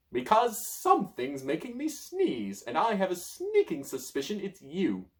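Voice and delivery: high, slow, accusing; suspicious wizard. Acted. Merlin accusing the child, high and slow. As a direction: narrow in on the child.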